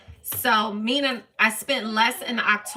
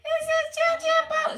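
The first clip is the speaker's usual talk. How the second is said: cutesy pet voice; 13 semitones higher than usual. Real, not acted. Showing off her cat to viewers.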